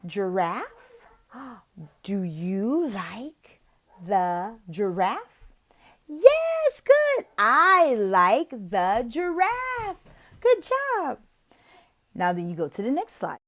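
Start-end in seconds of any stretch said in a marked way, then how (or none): 0.0-5.5 slow, soft, gentle asking; slow soft prompting of a young learner; slow coaxing question. Shown as a demonstration. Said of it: "you could say". 6.1-11.2 much higher, bright cheering praise; high delighted praise for a young learner; excited praise. Shown as a demonstration. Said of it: "you could say".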